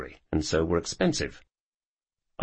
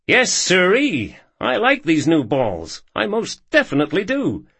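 put-on excited young cowboy; 8 semitones higher, 4 dB louder than usual. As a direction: crow over the shot, delighted. Ethan gloats after potting ball.

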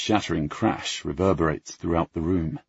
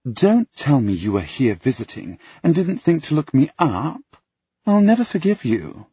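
high, loud, hammed-up reproach; a pouting socialite scolding; dramatic scolding; 9 semitones higher, 5 dB louder than usual. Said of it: "scowls dramatically".

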